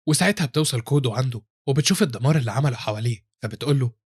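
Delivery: plain reading voice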